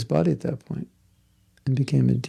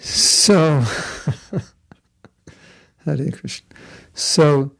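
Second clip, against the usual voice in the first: usual speaking voice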